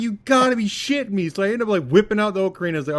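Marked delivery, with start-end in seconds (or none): 0.0-1.3 high, loud disbelief; indignant, worked-up mock protest; exasperated retold outburst. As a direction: reenact a gamer's outraged yell. Retold outrage at being frozen.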